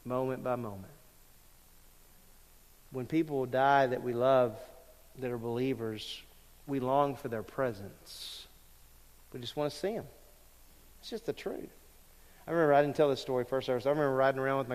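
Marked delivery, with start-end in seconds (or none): 0.0-11.7 softer, lower, hushed grief; quiet grief. Real, not acted. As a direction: let the loss pull the voice down. Grieving mother, quiet.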